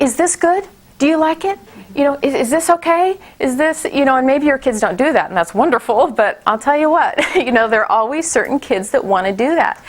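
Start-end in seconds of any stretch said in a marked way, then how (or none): 0.0-3.9 imitating a child, pitched higher, asking anxiously; mock the kid who wants a yes; approval-seeking child. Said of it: "you're doing the".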